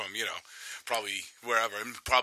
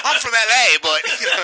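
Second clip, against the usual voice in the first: high, loud British put-on; hammed-up British voice; mock British accent; 11 semitones higher, 12 dB louder than usual. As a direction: laughingly imitate a British accent. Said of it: "the British part of L A".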